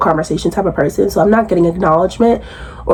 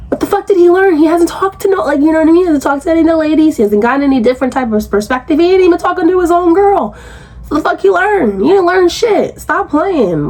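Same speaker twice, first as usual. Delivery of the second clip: exasperated rant; 7 semitones higher than usual. Real, not acted. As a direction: let the outrage climb. Ranting at his claim of learning.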